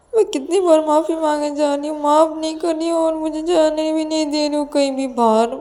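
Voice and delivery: high, whining protest; pleading Ananya, tearful. Acted. Scolded Ananya tells Payal she feels bad, tearful complaining. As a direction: complain in a long plaintive run.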